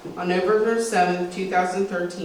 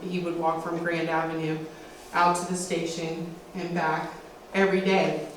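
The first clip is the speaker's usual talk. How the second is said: ordinary talk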